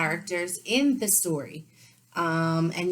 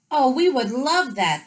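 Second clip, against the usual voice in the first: high, louder glad cry; cried out gladly; 8 semitones higher, 8 dB louder than usual. Acted. Horse and camel accept the invitation, high and loud. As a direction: burst out with delight. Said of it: "the Horse and the Camel cried".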